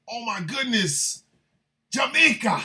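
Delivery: high, excited mock Jamaican shout-out; joking Jamaican accent. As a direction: call it out in a playful Jamaican lilt. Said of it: "I will joke at making a Jamaican accent".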